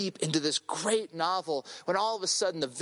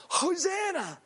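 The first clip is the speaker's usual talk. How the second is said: high-pitched cry, no added volume; mock crowd scream; 10 semitones higher than usual. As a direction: imitate a crowd's scream, held back in volume. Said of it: "screaming at the top of their lungs".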